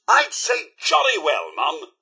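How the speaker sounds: normal talking voice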